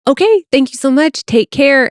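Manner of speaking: high-pitched, peppy thanks to close a call; cheery professional phone farewell; peppy sign-off. Shown as a demonstration. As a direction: wrap up the call with lots of pep. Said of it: "the peppiness at the end of the call".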